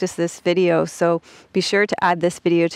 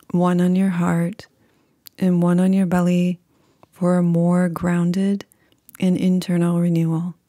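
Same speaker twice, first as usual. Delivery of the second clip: calm, unhurried meditation voice with long pauses; guided meditation. Acted.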